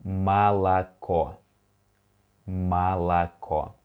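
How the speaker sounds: молоко said slowly as ma-la-KO, stress on the last O; slow Russian model word. Shown as a demonstration. Teacher slowly models молоко for students to repeat. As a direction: stretch молоко out, unstressed O's as A. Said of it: "the next one is".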